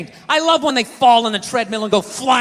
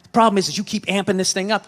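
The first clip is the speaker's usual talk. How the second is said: ordinary talk, nothing marked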